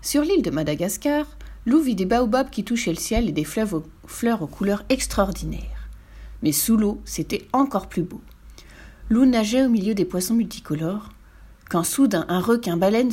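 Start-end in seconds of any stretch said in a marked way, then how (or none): none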